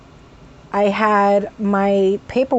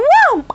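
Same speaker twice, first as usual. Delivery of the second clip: high, loud yelp; sudden involuntary burst; vocal tic whoa; 7 dB louder than usual. Real, not acted. Tic breaking into her apology story, high yelp. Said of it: "They were still coming out".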